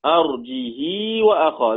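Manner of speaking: "أرجه وأخاه" slow, with a long "hii"; lengthened kasra, no hamza. Shown as a demonstration. Teacher repeating Warsh's reading, "أرجه وأخاه" as arjihii. سنجده بدون همزة.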